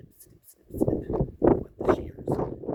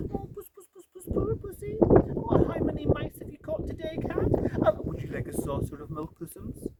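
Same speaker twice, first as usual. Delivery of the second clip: high, sing-song mocking baby talk to a cat; jeering. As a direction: taunt him like a pet kitten. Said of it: "jeering at him".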